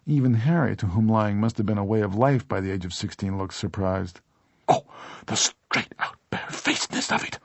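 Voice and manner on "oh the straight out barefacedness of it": breathy, scandalised gasp; shocked gasp. A narrator's reading. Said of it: "Mary Doolin gasped, shocked".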